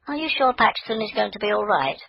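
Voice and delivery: put-on high child's voice, anxious; a small girl fretting over the deer; worried little girl.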